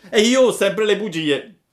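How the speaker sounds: put-on high, loud, fast puppet boy; Pinocchio confessing. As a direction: rattle off the confession cheekily. (Pinocchio sfacciato, alto e veloce)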